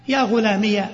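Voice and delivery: غلامي ending in an open ya; ghulamiya. Shown as a demonstration. Teacher showing the fourth form, final ي with a fatha. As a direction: sound the ي with a clear a after it. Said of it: "إثبات الياء مفتوحة".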